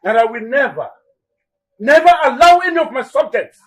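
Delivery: very high, loud, insistent; vehement refusal. Acted. King forbidding marriage to the enemy, vehement shout. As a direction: hammer home the ban at full pitch.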